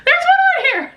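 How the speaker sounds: very high, loud delighted cries; thrilled shouts; excited squeal. Real, not acted. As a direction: squeal it out, rising each time. Excited at the shelf result.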